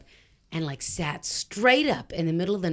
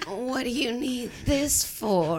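small-town pharmacist; 4 semitones higher, slower than usual. Pretend.

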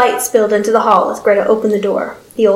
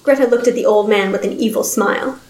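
plain reading voice

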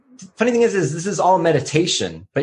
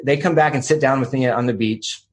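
normal talking voice

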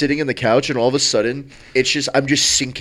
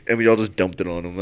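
normal talking voice

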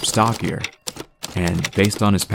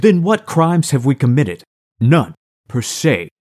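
indignant; 7 semitones higher, 5 dB louder than usual. Acted. Max confronts the mayor.

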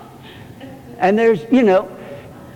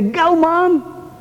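a lightly voiced kid cheering mom on, higher; 10 semitones higher than usual. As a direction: pitch it up like a kid urging Mom to go. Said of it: "youngsters who would say".

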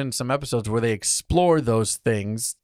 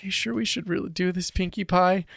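soft, higher timid pony voice; shy little pony, higher and softer; timid Fluttershy voice; 7 semitones higher, 4 dB quieter than usual. Retold or imitated. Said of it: "Fluttershy's just like".

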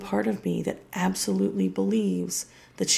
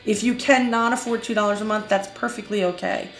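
upbeat, louder announcer read; bright podcast promo voice; podcast outro read; 3 semitones higher, 4 dB louder than usual. Acted. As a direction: sell the plugs like a host signing off.

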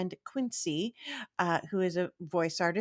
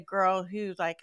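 ordinary talk

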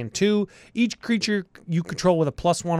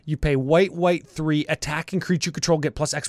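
ordinary talk

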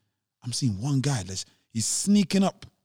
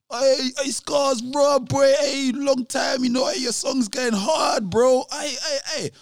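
hyped shouting; 10 semitones higher, slower than usual. Retold or imitated. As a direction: call out like an old hype friend. Blue greeting him hyped.

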